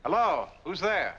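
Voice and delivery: calling out. Acted. Calling out into the dark brush.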